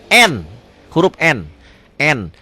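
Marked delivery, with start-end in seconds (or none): none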